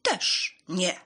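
slow, loud cat refusal; loud, spaced-out storybook cat; called-out refusal. A narrator's reading. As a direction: call it out word by word as the cat. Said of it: "zawołał biały kot".

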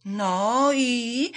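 drawn-out, expectant question; eager curiosity. A narrator's reading. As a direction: press them curiously for news. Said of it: "zapytały z ciekawością".

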